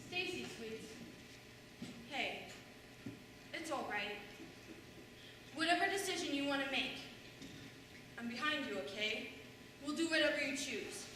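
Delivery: mother patting her daughter's shoulder, speaking softly; comforting daughter. Retold or imitated. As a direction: soothe her like a hurt child.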